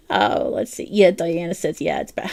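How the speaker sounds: ordinary talk, nothing marked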